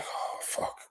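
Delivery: breathless whispered disbelief; stunned whisper. Retold or imitated. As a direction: whisper it as if you can't believe your ears. Stunned reaction to big news.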